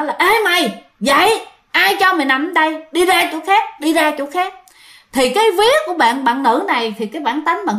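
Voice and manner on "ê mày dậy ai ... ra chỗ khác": high, harsh order to get up and get out; rude girl ghost voice. Retold or imitated. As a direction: shoo the sleeper off like a spiteful ghost girl. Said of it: "giọng con gái các bạn".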